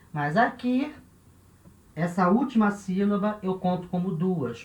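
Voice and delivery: usual speaking voice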